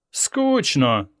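drawn-out, bored yawn on the word. A narrator's reading. Lounging lady on the sofa complains, yawning. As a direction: yawn through it like an idle lady. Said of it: "позіхає дама".

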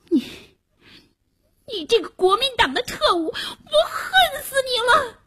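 high, tearful, furious yell; furious crying shout. A narrator's reading. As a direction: scream the hatred through tears. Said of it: "猫眼儿不由得怒火中烧".

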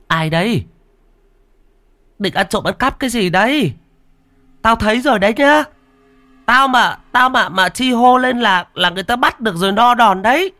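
very high, loud scolding shout; loud calling out. A narrator's reading. Woman challenging a thief in the banana garden, calling out loudly. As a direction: yell a warning out at a prowler. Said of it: "nói to vọng ra".

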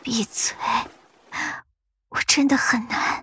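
weak, breathy near-whisper; trembling voice. Acted. A Yin cuts off the yaksha's lecture, trembling and weak. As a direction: force out a feeble plea through the pain. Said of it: "他声音颤抖道".

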